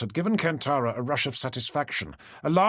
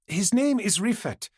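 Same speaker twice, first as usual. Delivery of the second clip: higher, pushing the name Rifat out hard; raised voice; 8 semitones higher than usual. A narrator's reading. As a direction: insist so she finally hears. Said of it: "Kantara raised her voice".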